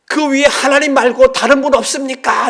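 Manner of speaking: high, loud shout up the cliff; desperate man asking for anyone but God; punchline shout. Retold or imitated. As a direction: holler the joke's punchline. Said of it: "이 집사가 이렇게 말했더래요".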